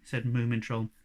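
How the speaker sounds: plain reading voice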